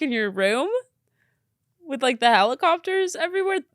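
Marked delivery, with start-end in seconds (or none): 1.9-3.6 incredulous, pitch up high; picture the chaos, marvel at it; amazed. Real, not acted. Reacting to a viewer's helicopter story, amazed and high.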